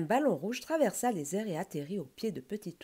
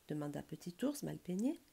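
ordinary delivery

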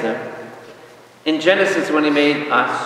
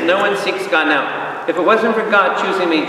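normal talking voice

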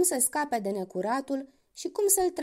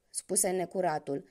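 plain reading voice

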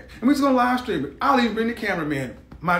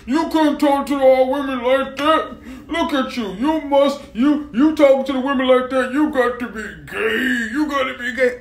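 imitating scolding women, higher and louder; hammed-up, raised indignant complaint; mock outraged women; 4 semitones higher, 3 dB louder than usual. Women scolding him over how he talks, mock outrage.

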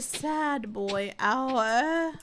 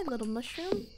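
ordinary delivery

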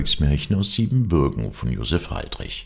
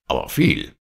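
mildly raised demanding voice; shouted order; 4 dB louder than usual. A narrator's reading. Wolf ordering plenty of food, mildly shouted. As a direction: add it as a firm demand. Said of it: "schrie der Wolf".

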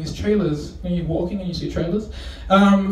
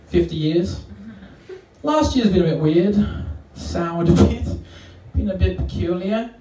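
normal talking voice

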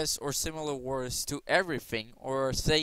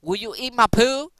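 silly high voice; 8 semitones higher, 10 dB louder than usual. Retold or imitated. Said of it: "It'll be like".

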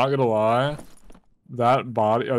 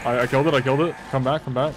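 ordinary delivery

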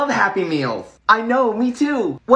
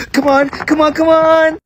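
rising, louder urging; tense urging; 5 semitones higher, 5 dB louder than usual. Acted. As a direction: push yourself against the clock. Kid straining at a timed challenge.

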